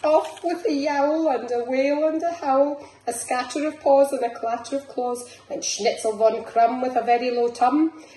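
lively picture-book chase; excited storytime. Acted. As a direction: race through the scattering dogs. Reads picture book aloud.

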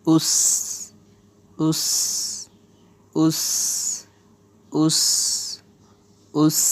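"us" with a long hissing s; "us" repeated singly, with pauses; drilled "us". Shown as a demonstration. Teacher shows alif pesh sin jazm, drilling "us". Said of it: "উচ্চারণ".

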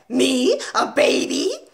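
high, loud outraged disbelief; affronted headmistress, voice leaping up; indignant shout. A narrator's reading. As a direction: shout it as two appalled questions. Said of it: "shouted the Trunchbull".

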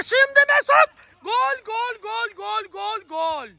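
high, excited goal call; screaming football commentator. Acted.

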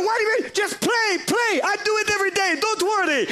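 high, breezy reckless driver; high carefree driver voice. Retold or imitated. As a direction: toss it off like nothing's wrong. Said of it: "and he's like".

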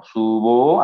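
"Subo" in stretched, separate syllables; syllable separation. Shown as a demonstration. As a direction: split "Subo" into su and bo, drawn out. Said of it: "Entonces se separa así".

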